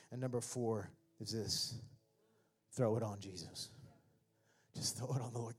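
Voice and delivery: usual speaking voice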